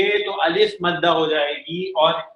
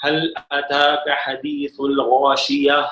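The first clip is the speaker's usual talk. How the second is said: Quran recitation; 4 semitones lower, slower than usual. Shown as a demonstration. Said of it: "اور آگے بھی چلیں".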